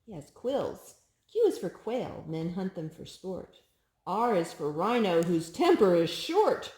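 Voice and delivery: lilting picture-book read-aloud; sing-song storybook reading; reading to a child. Acted. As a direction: read each rhyme brightly to a toddler.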